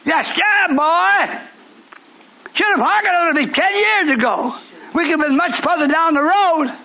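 high, emphatic preaching; a touch of pulpit fire; preaching lift. Acted. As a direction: press the point home.

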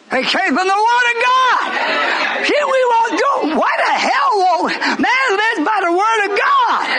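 high, shouting preacher's climb; fiery pulpit shout; preaching at full force. Acted. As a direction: hammer it out like a revival preacher.

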